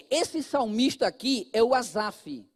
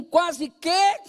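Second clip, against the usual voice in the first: "quase que" shouted higher and louder; shouted emphasis; 5 semitones higher, 3 dB louder than usual. Shown as a demonstration. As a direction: belt out "quase que" with rising urgency. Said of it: "diga assim". ("quase que" gritado, mais agudo e forte)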